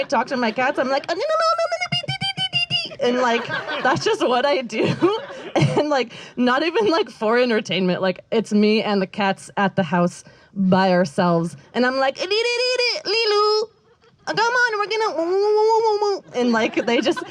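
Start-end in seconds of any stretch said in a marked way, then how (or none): 1.1-3.1 much higher nonsense babbling, dee dee sounds; made-up cat language. Retold or imitated. Talking to her cats in their private language, high babble. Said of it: "don't even speak English". 12.2-16.2 much higher nonsense babbling, dee dee sounds; made-up cat language. Retold or imitated. Talking to her cats in their private language, high babble. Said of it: "created our own language with the cats".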